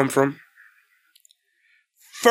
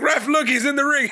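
mock urging; 9 semitones higher than usual. Voicing wrestler urging the referee.

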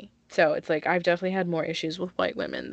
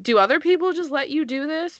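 higher-pitched mock indignant question; retold incredulous challenge; 9 semitones higher, 4 dB louder than usual. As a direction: challenge a pushy stranger, retelling it. Retold confronting of pushy strangers.

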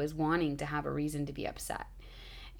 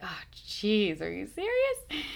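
high, slow, exasperated "are you serious?"; a pitched-up mock whine of disbelief; drawn-out, whining disbelief at the stuck bag; 4 semitones higher, slower than usual. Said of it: "I'm like".

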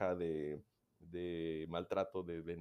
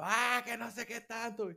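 much higher, louder mock child yell; imitated child yelling; 6 dB louder than usual. Mimicking a shouting child in a noisy room, high mock yell. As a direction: yell like a tantruming kid. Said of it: "tienes al niño que está gritando".

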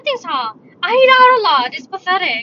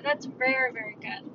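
much softer, trailing away; soft echo; 4 semitones lower, 10 dB quieter than usual. Real, not acted. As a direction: repeat it under your breath, fading. Softer repeat of praise.

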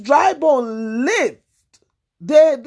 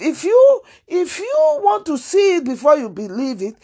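tearful plea; 5 semitones higher than usual. Real, not acted. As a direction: voice breaking with emotion. Cry heard, emotional plea.

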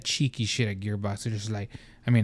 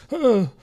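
sharp gasp; 11 semitones higher, 4 dB louder than usual. Real, not acted. Sudden gasp mid-rant.

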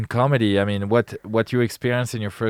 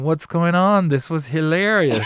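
baffled inner voice; 6 semitones higher than usual. Retold or imitated. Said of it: "in your head going on".

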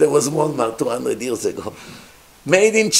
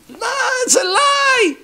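outraged Karaite yelling his denial at the king; screaming in outrage; 12 semitones higher than usual. Acted. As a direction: shriek it like you've been exposed. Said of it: "The Karaites screamed".

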